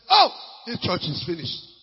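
loud exclaiming aside; preacher retells his own loud reaction. Retold or imitated. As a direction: blurt it out in shock. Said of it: "I say".

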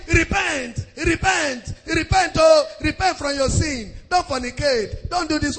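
mock exhortation, high and insistent; mimicked repentance preaching. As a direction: imitate a preacher hammering out commands. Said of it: "It's tired of saying".

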